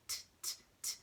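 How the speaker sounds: crisp voiceless T ticks; short, separate tongue-tip clicks; ticking watch. Shown as a demonstration. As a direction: tick evenly like a watch. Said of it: "Sounds like a watch".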